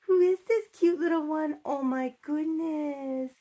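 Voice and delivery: gasping delight. Real, not acted. Collector gasping with delight at the baby's face. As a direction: gasp and gush with delight.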